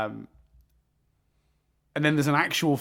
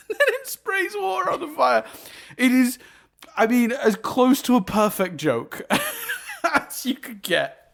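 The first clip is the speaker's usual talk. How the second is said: laughing; 12 semitones higher, 4 dB louder than usual. Real, not acted. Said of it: "It's so good".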